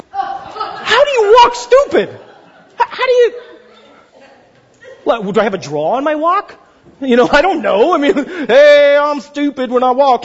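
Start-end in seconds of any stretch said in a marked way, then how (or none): 0.1-3.4 high-pitched, drawn-out disbelief; baffled, stretching the question way up high; incredulous. Retold or imitated. Waiter puzzling over his boss's insult, incredulous. 8.5-10.2 slow, loud, higher goofy voice; hammed-up dopey announcement; mock dimwit. Waiter mimicking his own supposedly stupid walk, mock dimwit. Mocks the insult as a character.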